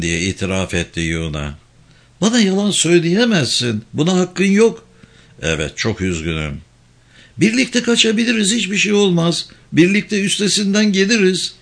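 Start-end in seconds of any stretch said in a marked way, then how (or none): 2.2-4.9 higher-pitched indignant protest. A narrator's reading. Woman answers the driver's confession of lying, indignant. 5.4-6.6 low-pitched, subdued regret; subdued apology. A narrator's reading. Driver admits lying to upset woman, subdued and sorry. 7.4-11.5 high-pitched urgent pleading. A narrator's reading. Woman begs driver to flee together, pleading.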